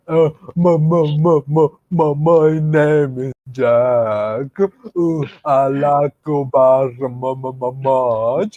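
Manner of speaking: slow, dopey mock stutter; imitating a stammering film character, drawn out. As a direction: stumble repeatedly on the first syllable, hammed-up. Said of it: "like, stuttering".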